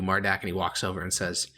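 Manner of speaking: ordinary talk, nothing marked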